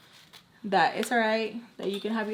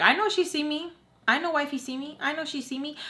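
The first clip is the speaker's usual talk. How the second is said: imitating his cocky talk, pitched up; cocky flirt impression; 6 semitones higher than usual. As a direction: brag to friends as a self-assured flirt, higher voice. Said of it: "that's how he was talking".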